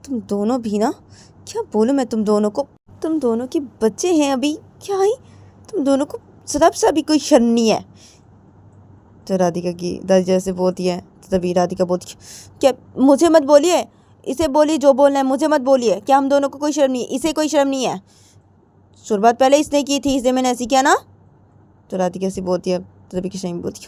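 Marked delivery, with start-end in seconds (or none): 0.1-7.9 a bit higher, reproachful elder; tut-tutting at two shameless women; scolding granny. Acted. Grandmother scolding both, mildly reproachful. 12.6-21.0 don't blame me, blame her; defensive retort. Acted. Radhika arguing with grandmother, higher and indignant.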